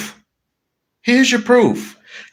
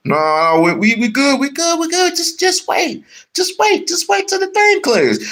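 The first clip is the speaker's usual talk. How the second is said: high frantic reassurance; 9 semitones higher than usual. Acted. Acted calming friend.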